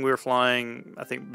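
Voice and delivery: normal talking voice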